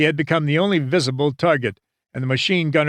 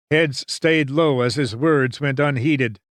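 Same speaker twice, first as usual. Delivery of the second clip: ordinary delivery, nothing marked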